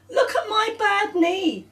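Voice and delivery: put-on very high little girl voice; Claire's voice. Girl showing Robert her knee, very high. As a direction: pipe it up like a proud child.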